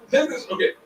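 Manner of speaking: ordinary talk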